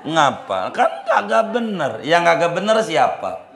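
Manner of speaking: a light, amused telling-off; mock scolding. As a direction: scold the nosy one half-jokingly.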